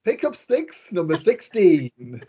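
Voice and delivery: sing-song rhyme then slow number; bingo caller. Shown as a demonstration. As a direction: call out like a bingo hall caller. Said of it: "is that your bingo voice".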